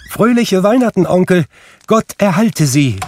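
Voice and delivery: put-on high, loud cheerful call of a young nephew; cheerful greeting. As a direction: burst in calling out joyful greetings. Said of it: "rief da eine heitere Stimme".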